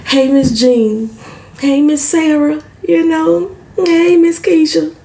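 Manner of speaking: a hint of a Southern belle calling hello; Southern polite greetings. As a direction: sweetly greet each lady, stretching Miss. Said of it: "I love to hear it on T V".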